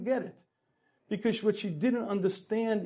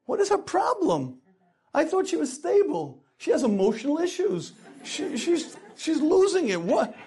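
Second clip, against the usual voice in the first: high, loud, incredulous "whoa"; can't believe what just hit him; husband muttering to himself, stunned and defensive; baffled indignation; 6 semitones higher, 6 dB louder than usual. Acted. Husband baffled by wife's outburst.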